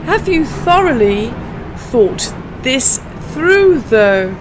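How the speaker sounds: slow, spaced tongue twister, through drawn out; slow it down so learners can follow. Shown as a demonstration. Teacher slowing the twister for non-natives, slow and careful. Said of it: "let's try this tongue twister".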